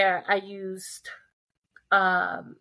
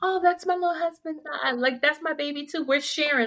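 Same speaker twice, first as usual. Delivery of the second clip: put-on little girl; 7 semitones higher than usual. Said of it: "little girl come and be like".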